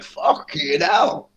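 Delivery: long drawn-out stunned swearing, rising at the end; stunned disbelief. Retold or imitated. Friend reacting to the psychic's reading, stunned disbelief. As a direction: stretch it out in retold amazement.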